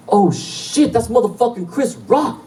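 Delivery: put-on high drunken holler of star-struck excitement; loud, not subtle. Drunk fan spots Chris Rock walk in, loud holler. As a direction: blurt it out high for the whole place to hear. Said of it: "Loud, not subtle".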